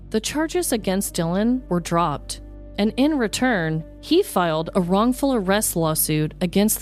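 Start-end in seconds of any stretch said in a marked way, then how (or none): none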